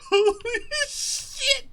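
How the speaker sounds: slow, high, loud awed holy shit; amazed. Retold or imitated. Watching the girl stop crying, stunned and drawn out. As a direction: gasp it out in disbelief. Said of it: "I'm like".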